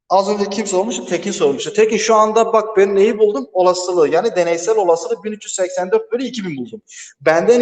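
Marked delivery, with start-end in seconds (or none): none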